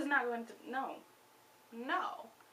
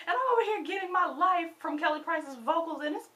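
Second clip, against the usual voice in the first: heated rant; 6 semitones higher, 8 dB louder than usual. Real, not acted. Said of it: "I'm mad about it".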